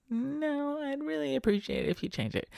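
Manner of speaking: high, soft mock lady voice; lady passenger. Lady passenger asking for another song, imitated in a high voice. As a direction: put on a high-pitched pleading woman. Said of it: "then she's like".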